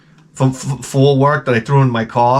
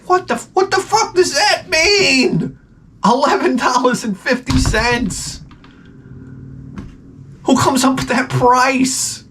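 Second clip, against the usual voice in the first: high, slow disbelief at the price; incredulous; 10 semitones higher, slower than usual. Retold or imitated. Reacting to the seller's odd price, high slow disbelief. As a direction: squeal it like the price is absurd. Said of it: "I was like".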